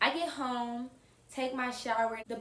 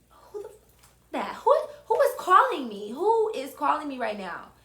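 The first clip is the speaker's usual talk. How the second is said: loud, higher freaked-out demand; alarmed outburst; 4 semitones higher, 7 dB louder than usual. Retold or imitated. After hanging up on the caller, a loud rattled outburst. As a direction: cry out who this is, rattled.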